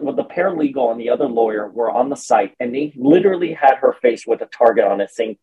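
ordinary talk

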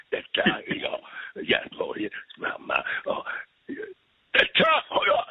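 hammed-up actor impression, cracking between high and voiceless; slow, husky Gothenburg caricature with sudden high bursts; Sven from Göteborg. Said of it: "typexempel på det är Sven, Sven från Göteborg". (överdriven imitation med göteborgska)

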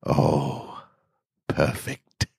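soft, breathy smug delight; smug purr. Acted. Smug doctor murmurs to herself over the cadaver, pleased. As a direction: savour it like a secret pleasure.

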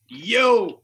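loud mock "Yo!" like a would-be teen; an old guy trying to sound young; old man imitating youth. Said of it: "les vieux qui imitaient les jeunes".

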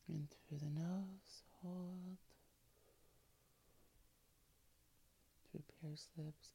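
hushed, breathy relaxation whisper; soft spa whisper. Acted. Earlier reader: soft spa whisper.

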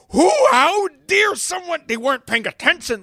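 high, loud indignant shout; hammed-up how dare someone yell; mock outrage. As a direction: blow up like an offended driver.

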